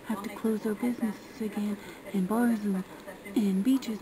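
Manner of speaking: ordinary talk, nothing marked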